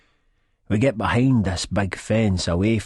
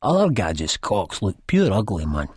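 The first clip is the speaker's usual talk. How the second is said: plain reading voice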